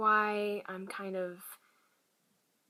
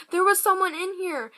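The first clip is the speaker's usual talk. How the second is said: frightened insisting; 10 semitones higher, 9 dB louder than usual. Retold or imitated. Scared child insists to her sister.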